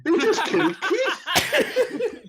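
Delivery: very high, very slow, half-laughing; baffled orcs. Acted. GM voicing the confused orcs after their friend dies, high and slow. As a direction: ask it like a dim, bewildered orc. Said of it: "the other seven orcs are going".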